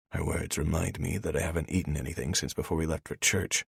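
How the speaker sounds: plain reading voice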